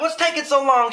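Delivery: high, mock-exasperated nagging; impatient demand. Acted bathroom-door quarrel.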